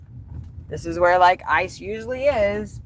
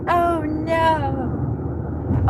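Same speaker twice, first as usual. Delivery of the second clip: high, startled little gasp of dismay; shocked oh no; 9 semitones higher than usual. Retold or imitated. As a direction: react like you just missed the turn. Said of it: "I was like".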